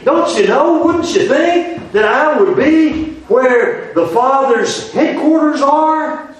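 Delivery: put-on high, slow, pleading boy's voice; young Jesus pleading with his mother; earnest young Jesus. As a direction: ask it as the boy in the temple, slow and high. Preacher voicing boy Jesus again.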